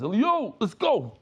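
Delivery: high, loud cry to get out now; acted-out alarmed shout, pitch jumping up; urgent call. Father calling his son to flee the place, urgent call.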